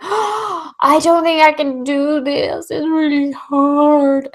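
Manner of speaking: high, slow, whiny wail of self-doubt; despairing whine. Retold or imitated. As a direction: whimper it like you're about to give up. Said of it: "I just went".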